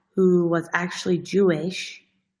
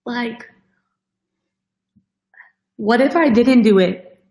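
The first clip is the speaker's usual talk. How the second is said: getting emotional; 2 semitones higher than usual. Real, not acted. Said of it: "I'm gonna get so emotional".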